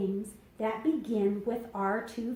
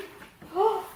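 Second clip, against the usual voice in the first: surprised gasp; 7 semitones higher than usual. Acted. Excited discovery while reading aloud.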